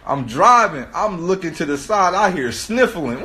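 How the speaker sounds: usual speaking voice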